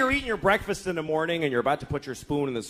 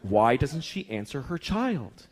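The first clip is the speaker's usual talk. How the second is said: judgmental onlooker. Acted. Childless onlooker judging a mother, sanctimonious. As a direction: build into a lofty, preachy vow. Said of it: "And you think".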